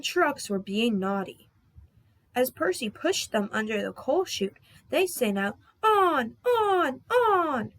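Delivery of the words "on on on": high, loud chanted call; loud playful chant; sing-song cry. Acted. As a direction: cry it out gleefully like naughty trucks. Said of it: "they cried".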